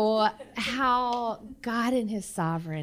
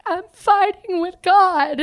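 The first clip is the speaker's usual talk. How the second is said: high, pleading crying friend; a hint of a sobbing teenager on the phone; voicing crying friend; 10 semitones higher, 6 dB louder, slower than usual. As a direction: mimic her desperate, teary plea. Crying friend voiced late at night.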